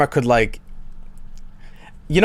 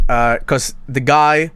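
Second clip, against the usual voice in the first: ordinary delivery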